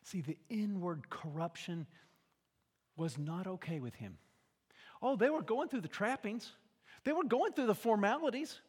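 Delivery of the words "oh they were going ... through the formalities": louder and higher, driving on; building preaching. Acted. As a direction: build it up from the pulpit.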